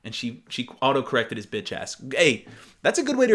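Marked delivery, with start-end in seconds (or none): none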